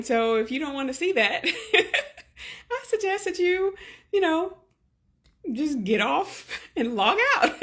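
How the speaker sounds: laughing through tears, high-pitched; half-crying laugh, joking; teary laughing. Real, not acted. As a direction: laugh it off while welling up. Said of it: "I'm about to start crying".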